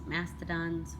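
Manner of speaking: ordinary delivery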